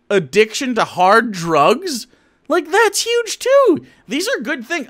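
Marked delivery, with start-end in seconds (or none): 2.5-4.9 high-pitched, drawn-out enthusiasm; slow, squealed-up emphasis; excited exclaiming. Real, not acted. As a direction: let the voice leap way up in amazement. Exclaiming over a second good thing.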